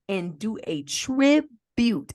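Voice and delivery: "tribute" drawn out slowly, syllable by syllable; slow, pointed emphasis on "tribute"; stress on TRIBUTE. Real, not acted. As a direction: lean hard on "tribute", stretching it out. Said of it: "put the emphasis on that word".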